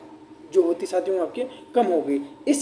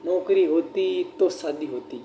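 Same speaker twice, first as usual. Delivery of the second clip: slow dictation; slower than usual. Shown as a demonstration. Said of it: "ये का ही उदाहरण लिखाया था".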